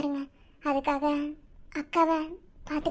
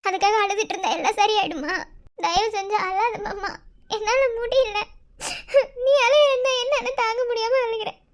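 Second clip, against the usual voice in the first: tearful pleading; 3 semitones higher than usual. Acted. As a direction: beg him to stop crying through tears. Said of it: "நீ அழுகிறதுனால சந்தியாவும் அழுகுறா".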